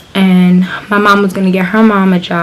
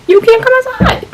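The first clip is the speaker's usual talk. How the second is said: much higher squeaky mock voice for the strict mom. Retelling how the friend's mom kept her inside, squeaky high mock voice. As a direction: lampoon the mom's rule in a high squeak.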